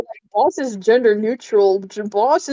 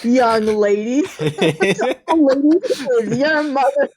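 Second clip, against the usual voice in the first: SpongeBob bit; 4 semitones higher, much slower than usual. Retold or imitated. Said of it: "SpongeBob and Patrick were selling".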